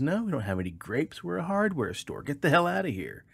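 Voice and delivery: high-pitched annoyed shopkeeper, lightly acted; irritated manager. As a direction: shoo the duck out of the store.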